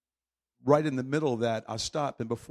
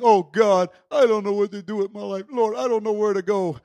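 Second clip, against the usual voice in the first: higher, louder pleading to God; desperate prayer; 6 semitones higher, 8 dB louder than usual. Retold or imitated. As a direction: cry out to God, lost and helpless. Congregant's desperate prayer, retold.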